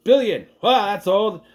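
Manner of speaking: high, carefree shrug of a voice; lightly voiced careless captain; dismissive captain. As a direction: play it like nothing matters. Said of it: "He's like".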